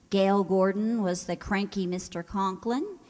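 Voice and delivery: ordinary talk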